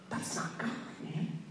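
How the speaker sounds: unvoiced whisper. Young preacher whispering to his pastor about the laughing lady. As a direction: hush it to the pastor beside you.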